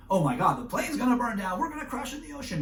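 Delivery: a passenger who smells fire and panics; panicked passenger. Acted. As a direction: pitch climbing high, rushing the last line. Imagined panic on burning plane.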